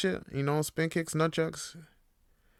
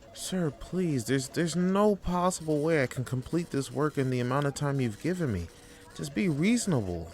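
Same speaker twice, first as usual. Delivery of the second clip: pleading employee; 7 semitones higher than usual. Acted. Employee begging boss.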